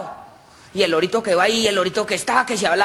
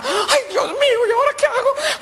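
panicking woman. Acted. Paola wonders what to do, wailing in panic. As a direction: wail in mock panic.

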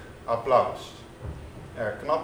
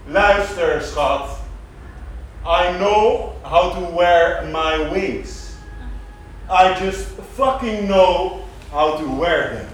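louder, higher voice in an acted quote; put-on voice, loud; 5 semitones higher, 8 dB louder, slower than usual. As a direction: deliver it big and loud, the English part slowed right down. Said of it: "Dus ik zeg". (luide, hogere stem in een gespeeld citaat)

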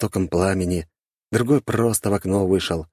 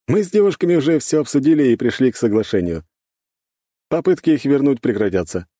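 higher, louder character voice; conciliatory blond man; 8 semitones higher, 5 dB louder than usual. A narrator's reading. Blond man tells his host of the agreement, conciliatory. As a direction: report the agreement in a raised, emphatic voice.

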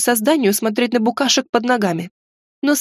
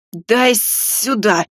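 hiss; much slower than usual. A narrator's reading. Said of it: "прошипел маг".

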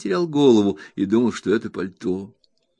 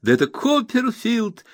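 much higher, delighted recognition; exclaiming; 13 semitones higher than usual. A narrator's reading. Traddles recognising Copperfield, delighted high exclamation. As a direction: burst out as you recognise him. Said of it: "вскричал Трэддлс".

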